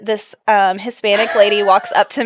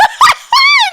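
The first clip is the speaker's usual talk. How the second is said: shrieking high laugh; explosive laughing shriek; loud burst of laughter; 5 dB louder than usual. Real, not acted. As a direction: crack up loudly. Embarrassing misunderstanding story.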